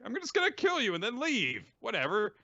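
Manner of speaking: high-pitched mock bravado; dismissive threat. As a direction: toss off the threat in a high acted voice. Villain threatens dismissively in story.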